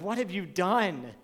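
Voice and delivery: imitating a parent's accusing question; mock-scold a guilty child. Parent demanding an answer from a misbehaving child, mock accusing. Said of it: "And usually in that tone, kind of".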